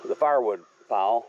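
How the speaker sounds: ordinary delivery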